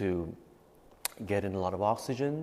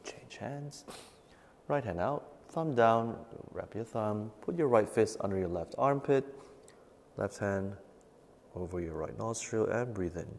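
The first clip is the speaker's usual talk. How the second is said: guided breathing meditation; 11 dB quieter than usual. Acted. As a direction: calmly guide each breath, fading to near whisper. Leads nine-round breathing meditation.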